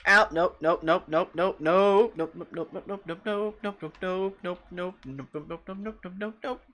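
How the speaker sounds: high rapid panicked "nope" chant; rapid high frightened nopes. Real, not acted. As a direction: babble nope while running away. Fleeing attack without armor.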